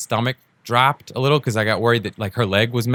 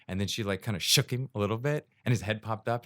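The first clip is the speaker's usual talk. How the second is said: usual speaking voice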